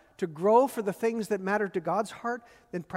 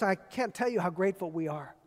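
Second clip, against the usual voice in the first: usual speaking voice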